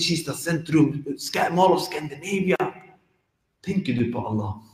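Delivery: ordinary talk, nothing marked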